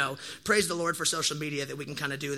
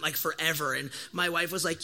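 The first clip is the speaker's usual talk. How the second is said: ordinary talk, nothing marked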